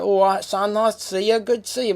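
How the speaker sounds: chirpy Cockney greeting to his son; Cockney parent. Retold or imitated. Parent greeting son in a put-on Cockney accent. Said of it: "They might have very Cockney parents".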